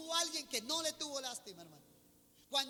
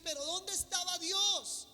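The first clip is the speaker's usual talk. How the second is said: doubting believers; 5 semitones higher than usual. Retold or imitated. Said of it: "Y muchos dicen".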